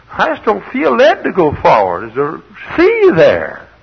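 high, loud protesting voice; mock reluctant follower.

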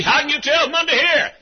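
high, loud disbelieving holler; incredulous question. Retold or imitated. Car owner doubts the visitor's diagnosis, incredulous.

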